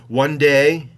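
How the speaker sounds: One day called out loud and high, like a preacher; preaching proclamation. Acted. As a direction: declaim it to the empty plain. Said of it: "my arms outreached as if preaching".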